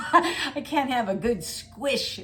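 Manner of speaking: words laughed out, high; real laughter; laughing. Real, not acted. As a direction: giggle through the words. Laughs mid sentence.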